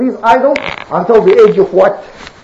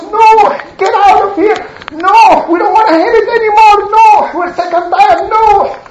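fed-up crowd; 9 semitones higher than usual. Acted. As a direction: drive him away, fed up. Crowd rejects Noah angrily.

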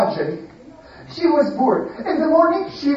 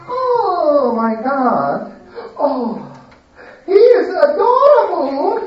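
high, slow, swooning gush; 7 semitones higher, 4 dB louder, much slower than usual. Acted. Lady swooning over the boy at the market, high and gushing. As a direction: melt over how cute he is.

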